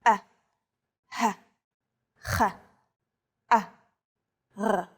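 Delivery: A, h, ch, a, r as isolated Hebrew throat sounds; guttural sounds. Shown as a demonstration. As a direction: sound out A, h, ch, a, r one at a time from the throat. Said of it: "are the guttural letters".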